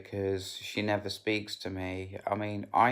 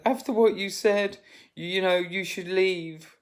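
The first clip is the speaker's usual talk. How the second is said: mother's retort; 10 semitones higher, 4 dB louder than usual. Retold or imitated. Mother voiced in retold argument.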